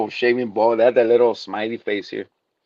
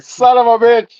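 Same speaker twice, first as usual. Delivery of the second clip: high, loud outburst; mock exasperation; 12 semitones higher, 8 dB louder than usual. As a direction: curse it out high and loud, hammed-up. Acted exasperation at slow counting.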